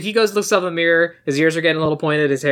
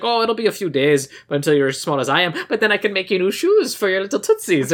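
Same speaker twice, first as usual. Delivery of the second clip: lightly put-on Irish lilt, a little higher; imitating tiny Irish mom; 3 semitones higher than usual. Retelling the leprechaun mom comforting her son. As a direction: coo it like a tiny Irish mother. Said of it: "she's like".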